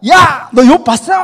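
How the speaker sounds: God bursting to brag about Job; God's eager shout. Acted. God boasting to Satan about Job.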